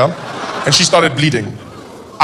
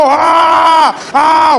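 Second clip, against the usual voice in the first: high, loud open wail; exaggerated child's "ah" cries; mock loud crying; 10 semitones higher, 6 dB louder than usual. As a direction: bawl in put-on pain. Child resumes crying loudly.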